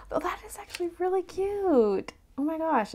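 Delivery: high cooing delight; overwhelmed by cuteness. Real, not acted. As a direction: coo over how cute it is. Cooing over figure.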